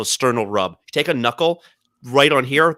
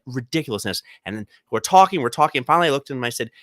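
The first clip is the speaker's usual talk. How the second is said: normal talking voice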